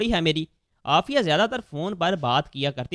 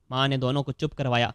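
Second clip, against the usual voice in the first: plain reading voice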